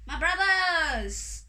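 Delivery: loud, high, drawn-out hail; long ringing call. A narrator's reading. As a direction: call slowly to a crowd above. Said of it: "called Anansi".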